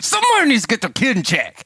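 mock indignation. Dad running around complaining, indignant. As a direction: imitate an indignant man complaining to everyone. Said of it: "I'm running around like".

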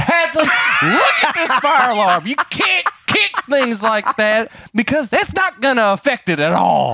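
high, slow, hammed-up lecture; exasperated scolding. Dad lecturing daughter on kicking, exasperated. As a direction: imitate a dad at his wits' end.

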